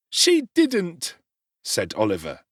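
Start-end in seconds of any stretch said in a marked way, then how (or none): 0.1-1.2 high, slow wounded protest; a child standing up for his mother; hurt boy. A narrator's reading. Oliver explaining to Sowerberry, hurt and high.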